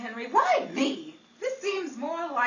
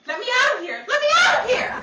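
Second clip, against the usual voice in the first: rising frantic yell, higher and louder on the repeat; panicked shout; 11 semitones higher, 9 dB louder, faster than usual. Acted. Betty panics and yells to escape the train. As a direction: scream to be let out, hammed-up.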